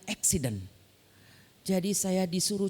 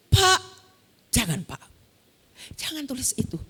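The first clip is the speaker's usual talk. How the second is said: retold heated plea to the teacher; fiery protest; 5 semitones higher than usual. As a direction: cry out to stop him, then plead. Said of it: "Kok membara marah sekali ya".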